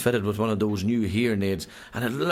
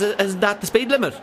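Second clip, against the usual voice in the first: high innocent voice; 11 semitones higher, 4 dB louder than usual. Acted. Driver's innocent high question.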